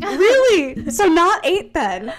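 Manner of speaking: high, loud, drawn-out disbelief; hammed-up, loudly sarcastic questioning; mock incredulity. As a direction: act out exaggerated surprise at being lied to. Klaus retold, sarcastic disbelief.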